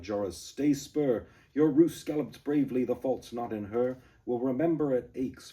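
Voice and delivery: loud, urgent cry; rider's cry. Acted. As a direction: cry out to Joris. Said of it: "Dirk groaned and cried".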